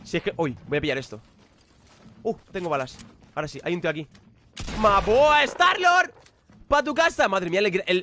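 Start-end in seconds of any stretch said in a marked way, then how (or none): 4.8-7.3 high, loud triumphant cry; victory shout. Real, not acted. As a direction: crow over the kill. Eliminating a player.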